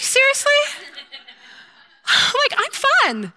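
a hammed-up squeal of offended disbelief; mock indignant. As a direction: play wounded pride for laughs. Acted indignation at rejected friendship.

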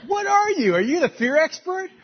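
high, loud mock disbelief; mock incredulous. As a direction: tease her as if amazed. Mock incredulous retort to wife.

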